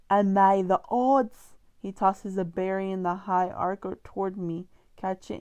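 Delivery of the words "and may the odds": slow, hammed-up British Capitol accent, a bit louder; mock British accent. Gale mimicking Effie at the reaping, hammed-up British accent. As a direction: drawl it like an overly upbeat British host. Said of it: "for that British accent".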